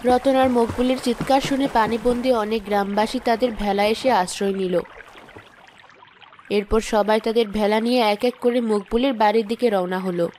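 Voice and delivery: story narration. A narrator's reading. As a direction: narrate the scene plainly to listeners. Story narration between scenes.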